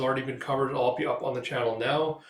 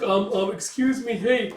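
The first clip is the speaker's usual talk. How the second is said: high, loud flustered calling; nervous elf; 8 semitones higher, 5 dB louder than usual. Acted. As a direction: call after him, flustered. Tied elf calls after Guts.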